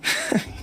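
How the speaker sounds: short derisive snort of a laugh; scornful laugh. Acted. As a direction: scoff at her boast. Bost scoffs at her threat.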